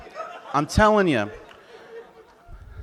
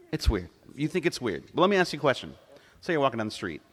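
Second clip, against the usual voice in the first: ordinary talk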